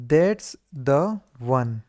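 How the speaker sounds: stretched out word by word, that's the one; very slow demo. Shown as a demonstration. Teacher to students, each word of that's the one drawn out. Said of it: "that's the one का क्या हो जाएगा".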